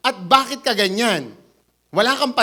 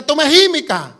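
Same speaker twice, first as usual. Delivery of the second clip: louder, firm command; 5 semitones higher, 6 dB louder than usual. Retold or imitated. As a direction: silence the sea. Jesus commanding the wind. (malakas at mariing utos na tumahimik)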